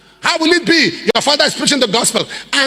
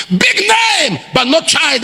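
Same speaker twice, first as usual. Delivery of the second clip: shout; 6 semitones higher, much slower than usual. Real, not acted. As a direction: shout it out to the congregation. Preacher shouting a point.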